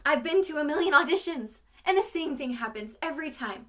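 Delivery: high, loud, bitter actress's lament; monologue, second take. Acted. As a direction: start from the moment before, voice pushed up. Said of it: "that was a little bit better".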